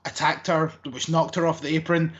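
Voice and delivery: ordinary talk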